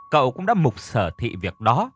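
plain reading voice

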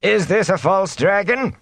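high-pitched, sour challenge; sour question. A narrator's reading. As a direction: demand it sourly, pitched high. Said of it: "the Thatcher asked sourly".

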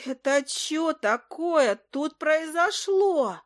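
high, drawn-out creature wail; scandalized little familiar; outraged familiar. A narrator's reading. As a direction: demand answers in a slow, high put-on voice. Familiar discovers the wrecked tavern.

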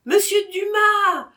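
high, loud shout of a name. Acted. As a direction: call out after him. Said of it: "criait la femme". (crié fort et aigu)

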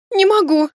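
acted high-pitched tearful outburst; sobbing. Heroine feigning distress before the mage, tearful sobbing. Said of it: "всхлипнула я".

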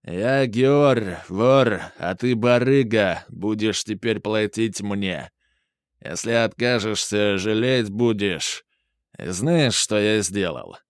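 imitating a thick Georgian accent; menacing Georgian accent, played for laughs. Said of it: "Антонов начал изображать грузинский акцент".

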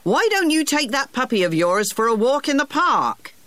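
put-on high old gardener voice tossed back over the shoulder; called over shoulder. Gardener sending the boy off to the park, high called voice. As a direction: call it back, high and gently bossy. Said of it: "he called over his shoulder".

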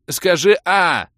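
high, loud, drawn-out prompt; coaxing request. A narrator's reading. Mage feeding the girl potion, loud slow prompting. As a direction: stretch it out slowly as if feeding someone. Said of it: "попросил я".

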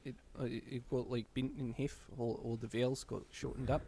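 soft attempt at a New Zealand accent; hushed mock New Zealand accent. Shown as a demonstration. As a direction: shorten the vowels, New Zealand style, quietly. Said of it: "the New Zealand accent".

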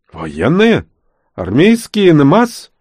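high, loud, agitated questions; flustered. Acted. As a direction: fluster and fuss. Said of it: "засуетился Назар".

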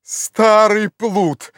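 high, very drawn-out scolding cry; long-stretched, high exclamation; relieved shout. A narrator's reading. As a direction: shout a relieved reproach up the tree. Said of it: "закричал Легран, испытывая, видимо, облегчение".

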